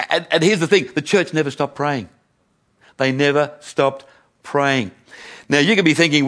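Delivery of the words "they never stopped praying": slow, weighty, with space between words; slow emphatic repeat. Real, not acted. Preacher repeats the key point to the congregation, slow and deliberate. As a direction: drive the point home slowly.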